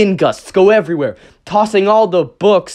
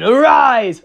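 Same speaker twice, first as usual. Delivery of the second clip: slow, louder command to rise; dark summoning; 6 semitones higher, 5 dB louder than usual. Acted. Noir Rabbit raising his minion, in a louder summoning voice. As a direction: intone it like a spell. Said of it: "So, Noir Rabbit's like".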